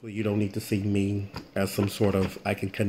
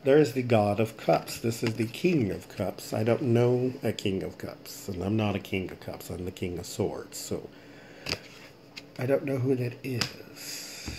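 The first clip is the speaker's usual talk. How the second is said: ordinary talk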